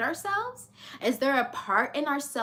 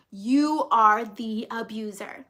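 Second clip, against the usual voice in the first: ordinary talk, nothing marked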